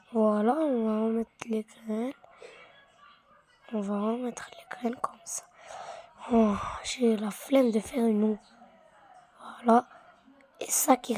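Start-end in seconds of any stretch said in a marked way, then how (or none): 0.1-5.4 whisper. Real, not acted. Whispers while replanting.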